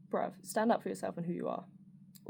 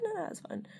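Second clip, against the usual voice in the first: much higher, light no-no; high mock-polite reassurance; high polite brush-off; 12 semitones higher, 3 dB quieter than usual. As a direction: chirp it off politely. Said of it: "and I was like".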